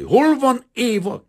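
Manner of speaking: high, slow, desperate roar; rasping demand. A narrator's reading. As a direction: demand it loudly, with a death-rattle rasp. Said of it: "kérdezte tőle hörögve". (hörögve, kétségbeesetten kérdezve)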